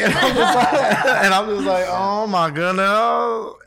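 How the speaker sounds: high, loud mock wail through laughter; comic drawn-out cry of disbelief; laughing exasperation. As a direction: crack up, then wail it long and high. Mock exasperation at the sales pitch.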